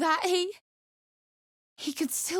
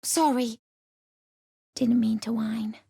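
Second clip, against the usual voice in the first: whispered apology; 8 semitones lower than usual. Acted. Companion apologizing for whining, whispered. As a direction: breathe the apology almost unheard.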